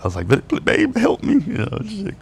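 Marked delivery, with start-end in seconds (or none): 0.3-1.3 very high, whimpering plea; squeaky pretend-crying voice; mock crying. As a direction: imitate yourself tearfully begging for help. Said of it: "crying, dude".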